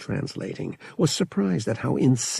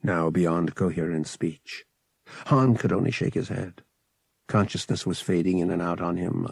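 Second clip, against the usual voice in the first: usual speaking voice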